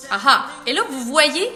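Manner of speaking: normal talking voice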